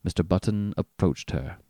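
ordinary delivery

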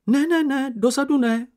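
high, loud, panicky refusal; frightened protest. Acted. Sniff refusing to walk last, high frightened protest. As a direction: squeal it out in fear. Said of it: "bránil se Čenich ustrašeně".

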